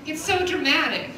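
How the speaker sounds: normal talking voice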